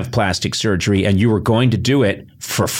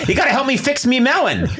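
high, cartoonish 1930s Brooklyn accent; 1930s Brooklyn guy; 12 semitones higher than usual. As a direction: ham up an old Brooklyn wiseguy. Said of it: "why am I talking like a nineteen thirties guy from Brooklyn?".

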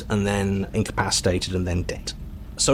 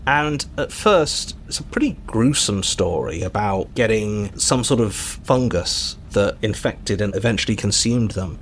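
ordinary talk

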